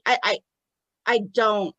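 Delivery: ordinary talk, nothing marked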